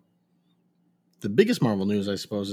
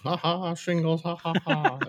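mock laughter; 7 semitones higher than usual. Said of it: "I'd laugh".

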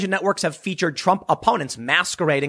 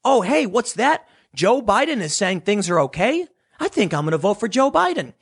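a touch of staged innocence, pitched up; mock undecided voter; 7 semitones higher than usual. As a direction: act the fake undecided voter. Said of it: "then they go".

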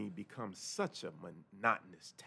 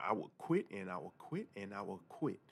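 usual speaking voice

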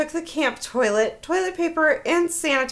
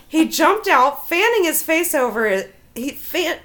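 normal talking voice

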